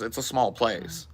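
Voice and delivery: ordinary delivery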